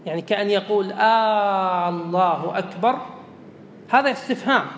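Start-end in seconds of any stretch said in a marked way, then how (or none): 0.9-3.0 "الله" opened with a long held "aa", like a question word; مد همزة الله. Shown as a demonstration. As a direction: stretch the first vowel of "الله" into a long "aaa", then finish "أكبر". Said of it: "لا إن مد الهمزة". (مدّ الهمزة في أول "الله" مدًّا طويلًا)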